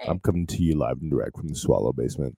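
hammy late-night DJ; radio DJ voice. Acted. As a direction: announce like a smooth radio host. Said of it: "it's me, the Green Eyed Dragon".

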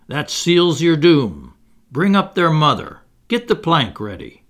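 roared out. A narrator's reading. Infuriated pirate captain ordering the plank, roaring. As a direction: roar out the orders. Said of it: "Hook roared out".

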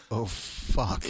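deflated realization. Retold or imitated. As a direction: let it sink in, imitating your dismay. Quotes own realization of mistake.

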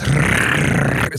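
rough rattling car-part noise; imitated bearing noise. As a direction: imitate a worn wheel bearing. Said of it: "it would make this".

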